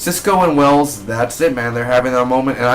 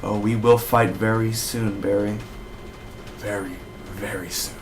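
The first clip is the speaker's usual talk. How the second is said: understated sinister vow; villain threat; 2 semitones lower, 5 dB quieter than usual. Retold or imitated. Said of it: "he basically says".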